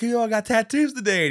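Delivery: put-on high, loud drunken exclamation; mock drunk. Wasted Owen to the others at the bar, drunk. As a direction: announce it loudly like a wasted man. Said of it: "he's, like, wasted".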